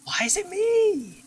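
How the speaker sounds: high, slow complaining wail; high whiny wail. Real, not acted. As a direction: whine it out high and drawn. Caught by monster.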